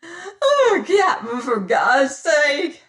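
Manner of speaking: high, loud, crying for mercy; terrified sobbing plea. A narrator's reading. Spraggins sobs to the captain for God's sake, terrified. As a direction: sob out the plea in terror.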